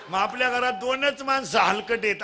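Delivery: ordinary talk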